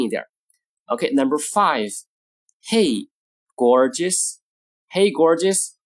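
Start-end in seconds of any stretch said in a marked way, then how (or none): 2.7-4.4 two words split by a pause; slow model reading. Shown as a demonstration. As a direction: separate the words for learners. Model phrase, slow take first.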